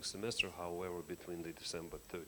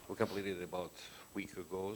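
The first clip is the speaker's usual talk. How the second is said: ordinary talk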